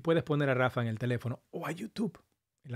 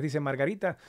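ordinary talk, nothing marked

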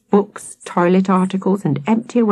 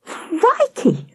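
high, startled squeak; stuttered alarm; 13 semitones higher than usual. A narrator's reading. Sam seeing the ghost, high startled stutter. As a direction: squeal it, taken aback. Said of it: "stuttered Sam".

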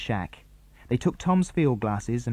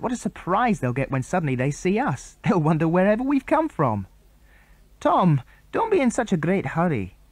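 put-on bright boyish chatter pitched up; boy character voices; 6 semitones higher than usual. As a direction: boys on the island plotting, pitched up. Tom and Andy voiced higher.

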